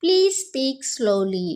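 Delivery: very slow, clear classroom English; slow model phrase. Shown as a demonstration. Teacher modelling the phrase for Telugu students, slow and clear. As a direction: spell it out slowly for learners. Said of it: "అప్పుడు ఇలా అనాలి".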